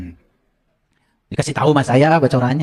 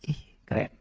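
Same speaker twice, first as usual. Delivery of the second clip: whispered awe; 14 dB quieter than usual. Acted. Mock awe aside.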